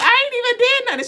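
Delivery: high, fast whiny protest; high whining denial to mom; protesting innocence. Retold or imitated. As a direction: squeal that you did nothing. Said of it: "I was like".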